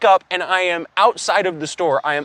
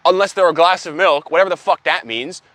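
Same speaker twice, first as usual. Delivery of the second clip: ordinary talk, nothing marked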